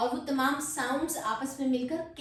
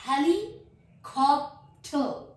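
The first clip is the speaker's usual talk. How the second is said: Helicopter slow and louder, in three parts; split into syllables; 3 semitones higher, 7 dB louder, slower than usual. Shown as a demonstration. Teacher modelling an easier way, word broken apart. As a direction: punch out heli, कॉप, टर one by one. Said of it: "तीन syllables मैंने इसके बना लिए".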